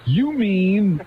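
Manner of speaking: high, gasping mock surprise; amazed infomercial woman. Host quotes the infomercial catchphrase in high mock surprise. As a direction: gush the question like a stunned pitchwoman. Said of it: "the operative line is".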